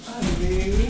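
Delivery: bright, loud loving hello; affectionate greeting. Real, not acted. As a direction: call out happily to a loved one. Partner arrives home mid-workout.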